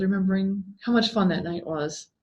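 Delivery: ordinary delivery, nothing marked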